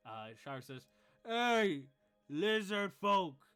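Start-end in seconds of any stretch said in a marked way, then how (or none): 1.3-3.5 high, loud, drawn-out bellow; half-orc yelling at the lizard folk across the room, loud and slow. Acted. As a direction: holler the challenge across the tavern. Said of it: "the half-orc yells at".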